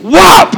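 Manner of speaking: high shouted whop as he swings; mock whack shout. Pastor mimes hitting the smug guy, shouted whop. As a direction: yell the smack of a board. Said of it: "find a two-by-four and go".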